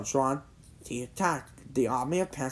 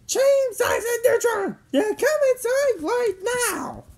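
high, loud motherly yell; mother calling out; 5 dB louder than usual. A narrator's reading. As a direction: call your son indoors at full voice. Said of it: "Jimmy's mother called out".

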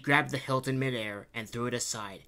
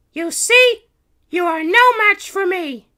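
very high, loud, cocky heroine; smirking princess; 16 dB louder than usual. A narrator's reading. Princess Kitty gloating over the Baron, smirking. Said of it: "she smirked".

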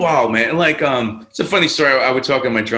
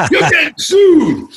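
much higher and louder, slowed, put-on voice; mock threat; 8 dB louder, slower than usual. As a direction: shout the threat as the angry owner. Owner threatening, retold.

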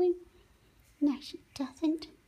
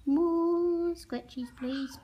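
slow, drawn-out pleading for more; 5 semitones higher, much slower than usual. Pretend. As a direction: stretch each word like begging. Voicing parrot's request.

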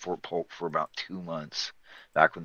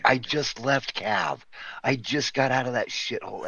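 retold exasperated protest; 6 semitones higher than usual. Retold protest to commanding officer.

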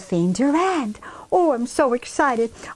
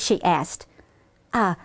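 ordinary delivery

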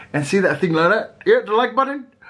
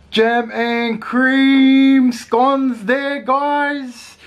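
excited; 9 semitones higher, 4 dB louder, much slower than usual. Real, not acted. Excited about scones.

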